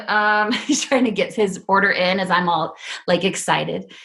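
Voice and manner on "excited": ordinary talk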